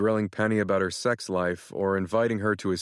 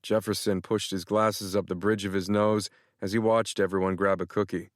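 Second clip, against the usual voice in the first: ordinary delivery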